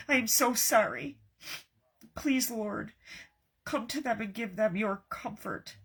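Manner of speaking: halting prayer, softening toward the amen; heartfelt prayer. Real, not acted. Crafter praying for bereaved sisters, heartfelt prayer. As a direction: plead gently with God, pausing between phrases. Prays aloud for viewers.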